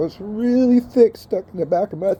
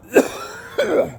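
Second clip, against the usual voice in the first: hacking smoker's cough; ragged coughing fit; harsh cough. Real, not acted. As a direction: cough it out hard. Coughing after hit.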